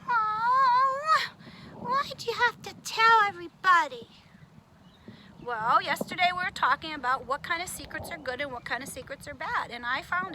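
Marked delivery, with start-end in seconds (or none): 0.1-4.1 loud high wail of dismay; upset cry. Acted. Puppet wailing at the teacher, upset cry. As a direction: cry out, hurt and betrayed.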